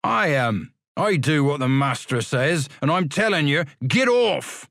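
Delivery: shout. Acted. Porter Ferriby driving the boys away, shouting. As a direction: order them away, ending in a shout.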